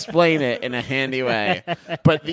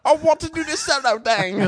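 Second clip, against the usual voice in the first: much higher, exaggerated [name] accent; overdone [name] voice, pitched well up; hammed-up [name] accent. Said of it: "also my Australian accent and my Scottish accent".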